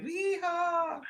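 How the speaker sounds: high, hammed-up mock yeehaw cry; mock troll yell. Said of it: "any little troll out there going".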